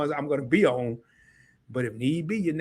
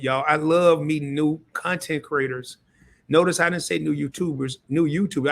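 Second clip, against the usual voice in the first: normal talking voice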